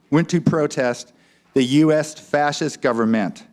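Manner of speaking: ordinary delivery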